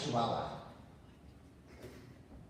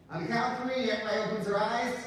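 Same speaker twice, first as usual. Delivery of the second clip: wake-up command; 7 semitones higher, much faster than usual. Acted. Hypnotist commands count to wake.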